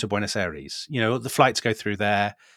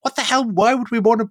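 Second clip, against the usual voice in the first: high indignant what the hell; mock outrage; 9 semitones higher than usual. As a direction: pitch it up in disbelief. Islanders' outraged retort.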